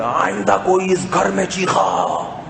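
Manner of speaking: slow, drawn-out scolding cry; hammed-up shout without real volume; mock yelling. Shown as a demonstration. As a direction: stretch it like a household warning. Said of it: "یہ نہیں کہ بیگم صاحب نے،".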